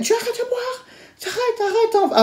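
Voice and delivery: very high, soft squeal; squeaky mock order. Telling her date to stop drinking, high mock squeal.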